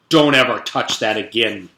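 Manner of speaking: loud, cold warning; stern order. A narrator's reading. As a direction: threaten the child, hard and firm. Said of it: "Missus Drizzle ordered".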